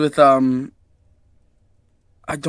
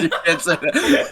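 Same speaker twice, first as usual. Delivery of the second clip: laughing. Real, not acted. Laughing scolding over Chinese joke.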